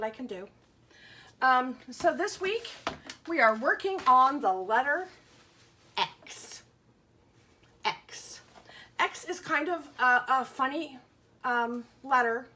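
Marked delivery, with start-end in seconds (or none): 6.0-8.4 X as a breathy unvoiced ks hiss; x sound. Shown as a demonstration. Teacher shows young pupils the letter sound, whispered ks. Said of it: "It makes the sound x".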